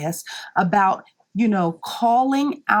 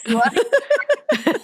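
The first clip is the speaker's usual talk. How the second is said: high, slow, laughing tease; laughing, drawn-out mock pitch; 12 semitones higher, much slower than usual. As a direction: stretch it out, cracking up at the pushy pitch. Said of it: "So here was this".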